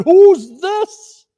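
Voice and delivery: high, loud question; surprised Fred. A narrator's reading. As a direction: ask in amazement. Fred at door.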